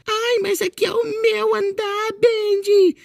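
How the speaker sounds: put-on high, slow whiny girl voice; mimicking Alice annoyed. Retelling how Alice scolded Bendy, high and whiny. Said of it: "Aí ela ficou brava".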